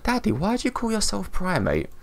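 put-on child's voice. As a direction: imitate your kid asking daddy a question. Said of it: "I was playing with the kids the other day".

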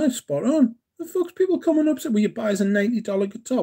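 high squeaky mock outrage, fast at the end; mock outraged voice. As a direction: squeal it like an indignant caricature. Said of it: "They're like".

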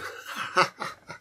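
acted dry chuckle; a short performed laugh from a character; narrated laugh. A narrator's reading. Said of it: "laughing again".

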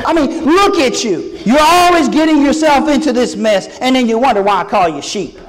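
high, loud mock scolding of a hopeless believer; hammed-up sigh at a foolish child; mock exasperated God. Pretend God scolding the congregation, a mock exasperated rant. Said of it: "God does not look at you and say".

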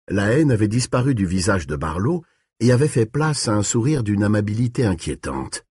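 usual speaking voice

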